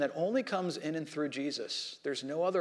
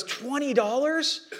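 drawn-out, much higher astonished question; retold gasp of an expert caught off guard; stunned disbelief; 8 semitones higher, slower than usual. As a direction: let the price floor you, slowly. Expert stunned by cheap price.

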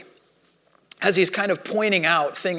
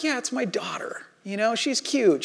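soft whispered musing about his sleepwalking daughter; half-whispered, not to wake anyone; whispered aside; 3 semitones higher, 7 dB quieter than usual. Retold or imitated. Father at night, thinking about his sleepwalking daughter, whispered.

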